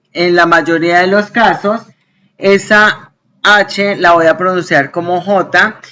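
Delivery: ordinary talk, nothing marked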